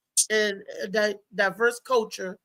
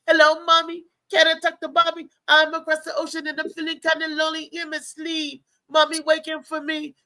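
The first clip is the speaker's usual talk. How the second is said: higher-pitched mock lovelorn caller; lonely phone sweetheart; 6 semitones higher than usual. Comedian phoning a faraway Jamaican sweetheart, hammed-up lonely pleading. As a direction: play a lonely lover pleading down the line. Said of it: "I got me a Jamaican one on the line".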